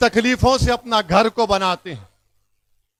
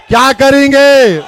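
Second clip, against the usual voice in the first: louder, pushing the crowd to answer; rallying shout; 2 semitones higher, 5 dB louder than usual. Real, not acted. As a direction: call it out to the whole crowd, demanding a reply. Rally crowd call and response.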